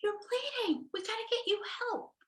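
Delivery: high, fast, worried mother; mock panicked parent, rushing the words; alarmed mother. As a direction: fuss over a bleeding child in alarm. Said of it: "my mother might say".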